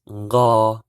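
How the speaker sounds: "ngo" loud with nasal start; teacher drilling nk syllable; Hmong nko. Shown as a demonstration. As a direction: clearly say "ngo". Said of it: "N Q is pronounced".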